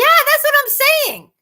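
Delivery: high, loud burst of agreement; emphatic agreement. Real, not acted. Streamer agreeing with viewer about geese, emphatic. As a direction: jump in loudly, totally agreeing.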